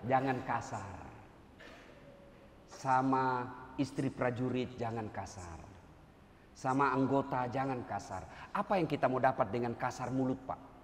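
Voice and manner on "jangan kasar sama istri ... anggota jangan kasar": low, slow, soft appeal; gentle plea. Acted. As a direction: plead softly with them.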